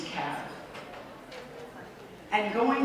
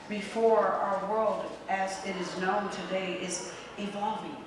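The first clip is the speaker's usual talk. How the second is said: ordinary talk, nothing marked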